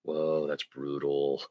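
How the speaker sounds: low, slow, soft awe; hushed reaction. Acted. Podcaster reacting to the movie's phone call, hushed and low. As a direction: let it sink in slowly, pitched low. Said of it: "it's like".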